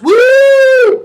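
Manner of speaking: high loud whoop; party whoop. Retold or imitated. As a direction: cheer like popping bottles. Party cheer.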